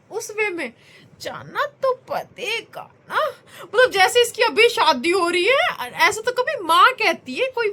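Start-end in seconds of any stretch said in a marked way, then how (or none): 1.2-3.3 put-on slow, sweet little-girl voice, half laughing; a mild copy of her five-year-old's tender line; imitating daughter. Mother imitating her daughter's grown-up line, sweet and slow. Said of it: "बोल के दिखाइए बेटा एक बार".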